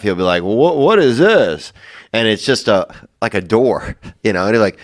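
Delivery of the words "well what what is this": high, slow, swooping puzzled question; exaggerated rise and fall of wonder; Norm Macdonald. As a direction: imitate comic bafflement, drawing it out. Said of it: "one of the ways that Norm makes you laugh".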